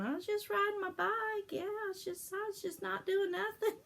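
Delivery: put-on high, whiny child's voice protesting innocence; pleading little kid denying it; innocent kid excuse. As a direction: play the kid swearing nothing happened, voice up high.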